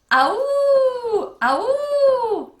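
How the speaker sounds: very loud, high, long howl; hideous howl. A narrator's reading. The Howler answers the campers, hideous howl. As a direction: howl like a monster in the woods. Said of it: "No one human could possibly make".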